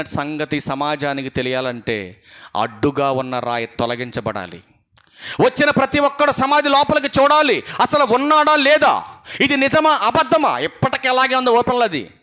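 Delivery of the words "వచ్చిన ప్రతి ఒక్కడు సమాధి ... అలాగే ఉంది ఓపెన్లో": higher, louder preaching cry; preaching at full force. Acted. Preacher urging the church to look into the tomb, full force. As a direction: drive the point home to the church. Preacher driving home his point.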